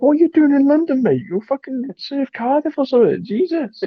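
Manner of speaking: high, loud disbelieving squeal; incredulous mock outburst. Mocking reaction to Welsh guy.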